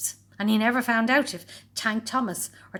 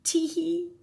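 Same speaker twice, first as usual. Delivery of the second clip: sneaky giggle; 10 semitones higher than usual. A narrator's reading. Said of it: "thought Horrid Henry".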